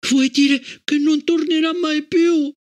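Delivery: put-on high, slow, appalled child's question; the dread sinks in; horrified. A narrator's reading. Gloria to her mother, horrified child voice.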